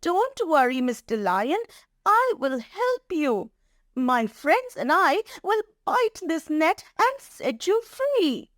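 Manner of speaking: high-pitched, reassuring mouse voice; helpful little mouse. Acted. Mouse promising to free the trapped lion, reassuring. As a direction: promise rescue in a small high voice.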